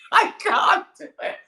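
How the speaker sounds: loud, high laughing wail; laughing surrender. Real, not acted. Comedian failing at accent, loud high laughing protest. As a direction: give up through high-pitched laughter.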